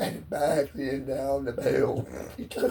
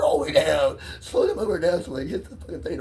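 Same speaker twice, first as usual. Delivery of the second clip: ordinary talk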